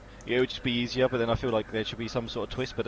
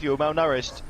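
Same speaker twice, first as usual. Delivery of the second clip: usual speaking voice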